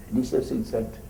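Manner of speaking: ordinary delivery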